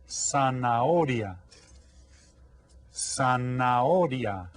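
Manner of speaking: "zanahoria" slow, silent h; slow model word. Shown as a demonstration. Teacher slowly drilling zanahoria, silent h. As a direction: stretch out "zanahoria" with no h sound. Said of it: "Can you say that?".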